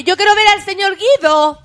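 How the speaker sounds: high, loud playful calling; teasing sing-song. Pretend. Host playfully calling out for señor Guido, high teasing voice. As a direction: call out for him like a kid teasing.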